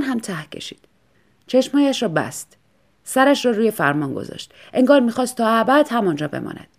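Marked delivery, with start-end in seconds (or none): none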